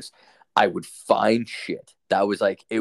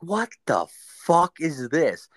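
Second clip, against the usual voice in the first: high, drawn-out disbelief; retold bewilderment; 8 semitones higher, 3 dB louder, slower than usual. Telling friends about finding burnt bills in the garage, retold bewilderment. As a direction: stretch it out slowly, pitched well up.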